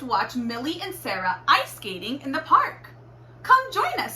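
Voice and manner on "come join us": lightly voiced girl. A narrator's reading. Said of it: "said Millie".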